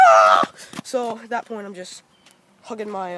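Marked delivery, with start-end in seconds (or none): 0.1-0.5 scream. Retold or imitated. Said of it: "I was like".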